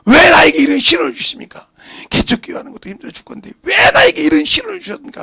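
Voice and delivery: loud, high, fast shouting complaint; shouted complaint to God. Acted. Struggling pastor shouting his complaint at God, furious. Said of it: "하고 이렇게 기도할 거 아니요?".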